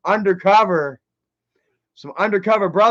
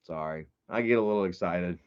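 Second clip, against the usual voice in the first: sheepish apology; 13 semitones lower, 8 dB quieter than usual. Real, not acted. Apologising after yelling.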